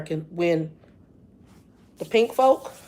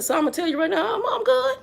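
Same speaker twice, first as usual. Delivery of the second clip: playful self-assurance; 13 semitones higher than usual. Retold or imitated. Retelling her answer that she's good, in a playful high voice. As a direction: voice sliding up very high.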